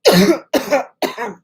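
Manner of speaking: dry coughing fit. Real, not acted. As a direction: cough through an itchy throat. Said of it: "My throat is itching".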